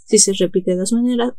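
ordinary talk

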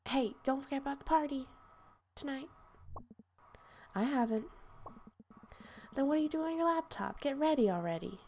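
high, fast nagging friend. Acted. Friend pushes her to get ready for the party, high and fast. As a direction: pitch it up and hurry like an impatient friend.

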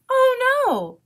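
high, loud opening wail; worried duck crying out, high then dropping; alarmed cry. A narrator's reading. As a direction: cry out in storybook dismay. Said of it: "cried Duck".

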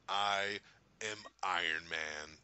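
slow, low, deliberate declaration; Iron Man. Retold or imitated. Fan reenacting Tony's final line, slow solemn declaration. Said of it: "and he's like".

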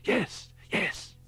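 high, breathless urgent whisper. A narrator's reading. Summers whispers urgently at the bloody scene. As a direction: hiss it out high with eager urgency. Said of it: "he said urgently".

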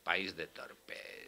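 resigned mutter. Real, not acted.

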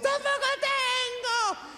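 very high, drawn-out screech; built-in scream. Acted. Screaming car's driver answering the cop, very high long screech. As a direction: wail it slowly like a siren. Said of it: "en vez de turbo lleva grito incorporado".

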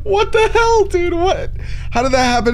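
startled yelp at a horror scene; shocked. Real, not acted. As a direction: jump up in pitch in disbelief. Finds grandma dead.

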